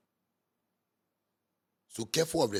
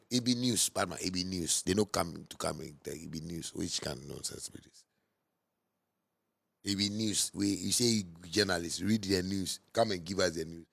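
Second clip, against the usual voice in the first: usual speaking voice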